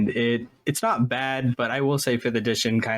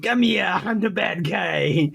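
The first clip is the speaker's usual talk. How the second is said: high, slow comic bad guy; goofy villain voice; 8 semitones higher, slower than usual. Host mocking up the ghost villain, in a goofy high voice. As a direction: draw it out like a silly cartoon villain. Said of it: "if I had to voice that character".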